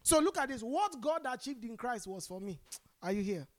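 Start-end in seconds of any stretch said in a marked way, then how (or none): none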